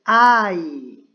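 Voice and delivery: "I" loud and drawn out; model pronunciation. Shown as a demonstration. Teacher has students repeat the pronouns, modelling "I" loudly. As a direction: say "I" slowly and loudly for repetition. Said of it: "repita después de mí".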